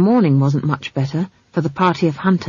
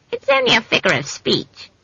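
higher-pitched, mildly hurt reproach; reproachful; 5 semitones higher than usual. A narrator's reading. Hunter excusing his words to the witch, reproachful. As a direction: protest with a touch of reproach. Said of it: "said the head reproachfully".

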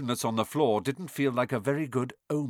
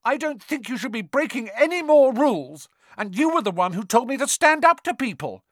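Neville arguing; 12 semitones higher, 5 dB louder than usual. A narrator's reading. Neville argues his case.

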